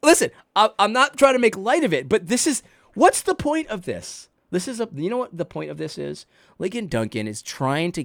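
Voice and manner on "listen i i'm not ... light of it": normal talking voice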